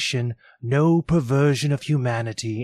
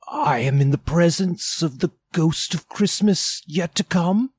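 higher, hushed awe of Scrooge; fearful Scrooge; 8 semitones higher, 3 dB louder than usual. Acted. Scrooge faces the silent phantom, uneasy and higher. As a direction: afraid to ask.

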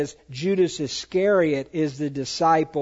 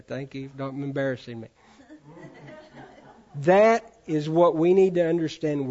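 ordinary delivery

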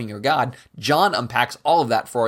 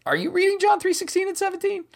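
incredulous; faster than usual. Retold or imitated. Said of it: "and I'm like".

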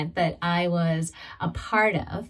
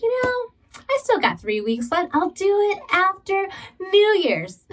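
procrastinating inner voice; 10 semitones higher than usual. Retold or imitated. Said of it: "it's like".

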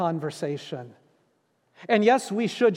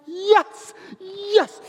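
high, loud, eager yes yes; excited yes; 11 semitones higher, 3 dB louder than usual. Retold or imitated. Pastor recalling his joy at the voicemail, thrilled. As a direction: leap at the good news. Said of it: "I was so pumped".

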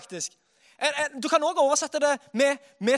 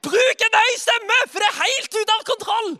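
preacher shouting with abandon; loud, out of control; 8 semitones higher, 7 dB louder than usual. Shown as a demonstration. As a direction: let it all out, loud and wild. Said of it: "Det er egentlig det".

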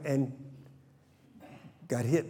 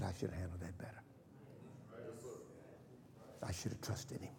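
much softer, hushed regret; soft regretful inner voice; 4 semitones lower, 17 dB quieter than usual. Retold or imitated. Preacher voicing a believer's regret, soft and hushed. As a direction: murmur it like a private regret.

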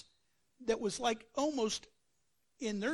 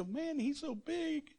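mock worried soldier; 6 semitones higher than usual. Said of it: "some of those guys go".

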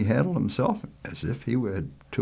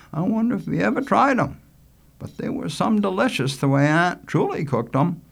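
put-on higher, louder young boy voice; higher-pitched, talkative island lad; chatty boy Richie; 11 semitones higher, 5 dB louder than usual. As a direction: play an eager country boy telling what he knows.

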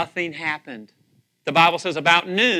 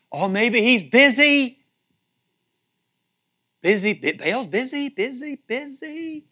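mock busy; 7 semitones higher, 4 dB louder than usual. Mocking Baal as busy.

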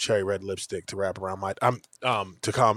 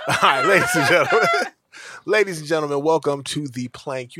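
loud laughing announcer intro; 4 semitones higher, 6 dB louder than usual. Acted. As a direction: belt the intro through laughs.

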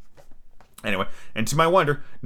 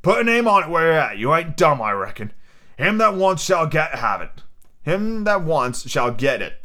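higher-pitched pirate captain's dare; Long John Silver, challenging; 5 semitones higher than usual. Acted. Silver challenges his silent crew, defiant pirate voice. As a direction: dare them as an old sea rogue.

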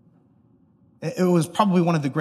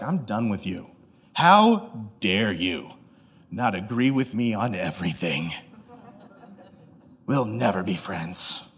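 low, slow, drawn-out offence; hammed-up icy finality, pausing between phrases; mock cold indignation; 9 semitones lower, 6 dB quieter, slower than usual. Cancelling someone who disagreed, in mock cold outrage. Acted cutting off of a critic.

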